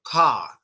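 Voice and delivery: Car with a wide open jaw; jaw-dropped car. Shown as a demonstration. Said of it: "The dropping of the jaw".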